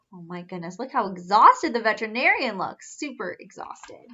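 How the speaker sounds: ordinary delivery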